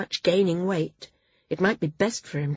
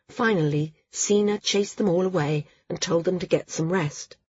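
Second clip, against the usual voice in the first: plain reading voice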